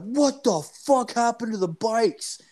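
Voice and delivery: very high, strained mock outrage; outraged bike owner. Bar owner finding his bikes knocked over, mock outrage. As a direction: play an incredulous owner in a high-pitched imitated holler.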